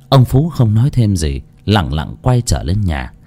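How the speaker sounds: usual speaking voice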